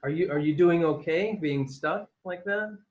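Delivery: ordinary talk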